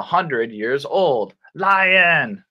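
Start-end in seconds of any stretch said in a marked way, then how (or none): none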